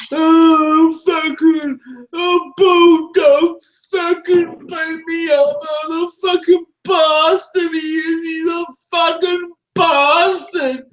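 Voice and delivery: high, wailing mockery of a crying rival; mock crybaby. Man mimicking rival moaning to mum, mocking wail. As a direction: whine it loud and drawn out, hammed-up. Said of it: "to your mum going".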